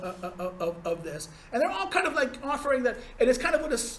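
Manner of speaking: normal talking voice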